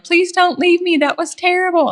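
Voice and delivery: high, begging whine; mock-pleading high voice; pleading daughter-in-law. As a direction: plead not to be left alone. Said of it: "and Kristen's like".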